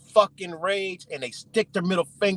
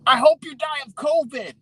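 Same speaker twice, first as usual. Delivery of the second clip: high, louder imitation of a hateful protester; mock angry yell; 10 semitones higher, 4 dB louder than usual. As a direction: yell it like an irate heckler. Retelling protesters' hostile yelled wishes.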